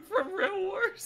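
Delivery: high, loud, anguished cry; dramatic cry. Pretend. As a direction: wail it like a tragedy. Mock dramatic outcry.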